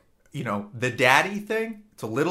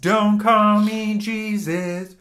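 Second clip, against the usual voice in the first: high, slow, laughing sexy voice; sultry mock voice; 8 semitones higher, 5 dB louder, much slower than usual. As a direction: purr it, hammed-up and giggling.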